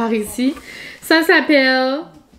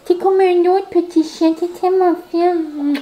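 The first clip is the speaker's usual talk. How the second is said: doggy mom voice; 5 semitones higher than usual. Real, not acted. Praising her calm dog, high cooing voice.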